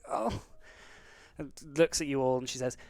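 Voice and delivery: put-on high, relieved old woman; an old woman, overcome with relief; relieved old lady. Old lady rushes out, hugs.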